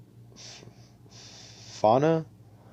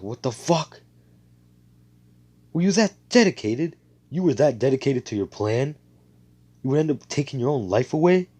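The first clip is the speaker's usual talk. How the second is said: higher and louder, outraged questions; angry disbelief; 5 semitones higher, 7 dB louder than usual. Retold or imitated. Asta yelling at the enemies who killed themselves, angry disbelief. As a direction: hurl the questions at them in disbelief. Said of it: "he's actually getting pissed".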